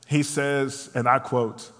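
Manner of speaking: ordinary delivery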